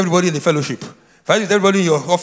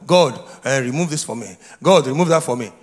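mock begging; 8 semitones lower than usual. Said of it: "you are begging God".

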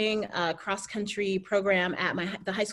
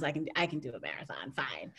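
sudden hushed whisper, much softer; whispered self-talk; 6 semitones lower, 8 dB quieter than usual. Retold or imitated. Runner whispering to herself mid-run, talking herself into the marathon. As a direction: whisper it to yourself like a private resolve.

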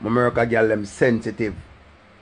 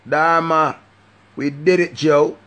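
called catchphrase; 5 semitones higher, 5 dB louder, slower than usual. Acted.

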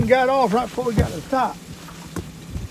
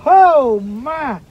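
surprised; 4 semitones higher, 8 dB louder than usual. Real, not acted. Big fish on the line.

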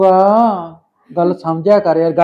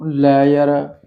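ordinary talk

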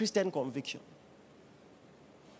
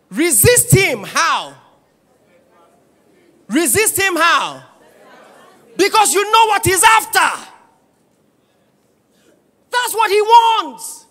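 much higher, louder preaching shout; preaching at full force; 12 semitones higher, 16 dB louder than usual. Real, not acted. Pastor warning the church, shouting. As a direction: shout it at the devil's schemes.

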